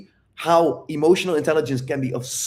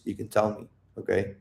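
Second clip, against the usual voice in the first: soft coaxing; 4 semitones lower, 6 dB quieter than usual. Shown as a demonstration. Coaxing girlfriend softly to open up.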